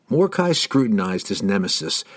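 ordinary delivery

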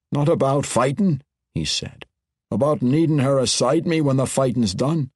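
dwarf refusing to let her go; dwarf king voice. A narrator's reading. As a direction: voice the dwarf lord, pained. Bruner explains in dwarf voice.

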